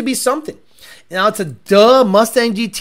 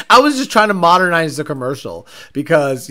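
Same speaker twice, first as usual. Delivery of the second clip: ordinary talk, nothing marked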